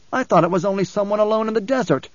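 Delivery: high-pitched defensive protest; protesting youth. A narrator's reading. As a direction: excuse yourself to your master. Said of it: "the youth protested".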